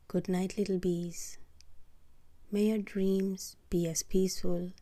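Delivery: ordinary delivery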